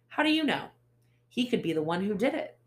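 high, loud, quick retort; arguing with the detective, high and loud; indignant challenge. Acted. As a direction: push back hard, quickly. Narrator argues with Ace.

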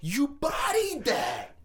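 high, slow, drawn-out shouted praise; hyped shout. Real, not acted. Cohost praising the actor's accent, hyped shout. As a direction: shout it high, stretching the words.

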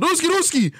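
high, loud mock Russian yelling Ruski; mock Russian shout. Bouncer asks his costume, loud mock Russian answer. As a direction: bark Ruski like a cartoon Russian. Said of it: "I just said".

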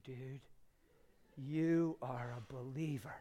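soft, hushed encouragement to the mirror; quiet, sincere talk to his own reflection; tender pep talk. Retold or imitated. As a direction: speak to yourself gently, like a proud friend. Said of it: "I said".

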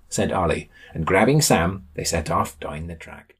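usual speaking voice

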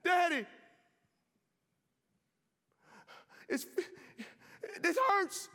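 high, loud anguished cry to his father; crying out in pain. Acted. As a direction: cry out like a dying son in agony. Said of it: "His son starts crying out".

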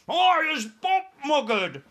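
very high, loud, slow screech; screeching giant. Acted. Tied-up giant screeches at his captors, very high and loud. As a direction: screech it at the sky. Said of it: "screeched the butcher boy".

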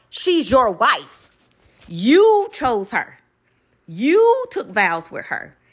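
slow, every word weighted; deliberate lecture; slow emphatic. Real, not acted. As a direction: lay it down slowly. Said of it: "preaching point slowly".

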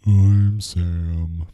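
low, drawn-out gloomy introduction; Eeyore. Pretend. Co-host retrying the show intro, gloomy and low. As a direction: drag it out low and mopey. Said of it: "Like Eeyore".

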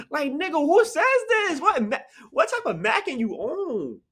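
high-pitched, exasperated disbelief; baffled, pitch shooting up; incredulous outburst. Real, not acted. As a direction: cry out as if you can't believe it. Baffled reaction to read lyric.

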